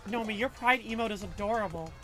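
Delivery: ordinary talk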